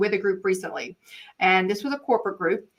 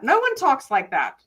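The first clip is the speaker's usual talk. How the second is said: usual speaking voice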